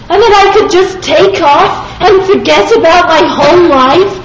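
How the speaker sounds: high, loud, close to tears; anguished self-questioning. Retold or imitated. Speaker torn, questioning himself in distress. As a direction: argue with yourself in distress.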